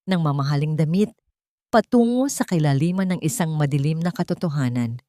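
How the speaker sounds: ordinary delivery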